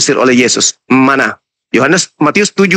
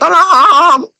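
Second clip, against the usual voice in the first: high, shrill-pitched mock pleading of a trapped thief; mocking cry for help; 11 semitones higher than usual. Preacher mocking debaters as a thief crying for help, high mock wailing. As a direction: ham up a thief tied to a tree begging. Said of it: "kalian hanya bisa teriak".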